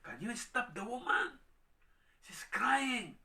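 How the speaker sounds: high, loud, slow exasperated complaint; annoyed complaint. Acted. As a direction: beg Jesus to send her away. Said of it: "sabi nga".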